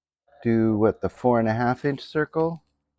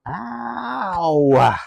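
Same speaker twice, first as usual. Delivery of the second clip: sharp high yelp of pain; real sudden ouch; 10 semitones higher, 5 dB louder than usual. Real, not acted. Cut finger on sander.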